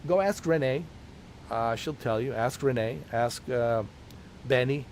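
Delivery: ordinary talk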